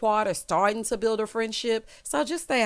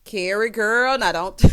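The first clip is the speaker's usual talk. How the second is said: usual speaking voice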